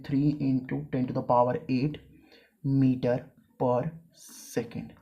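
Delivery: very slow, one term at a time; teacher dictating the formula. Shown as a demonstration. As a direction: spell the equation out for note-taking. Dictating a value.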